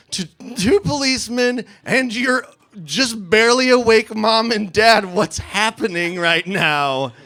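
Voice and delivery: high, strained pleading, easing down at the end; mounting panic. Acted. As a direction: give tips as if reliving the disaster. Panicked advice reliving humiliating night.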